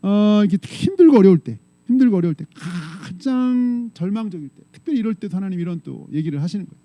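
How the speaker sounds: usual speaking voice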